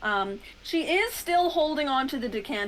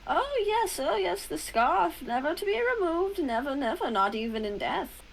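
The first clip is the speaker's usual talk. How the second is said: Demelin voice; 6 semitones higher than usual. Acted. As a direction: intone it like an ancient guardian. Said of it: "Demelin goes".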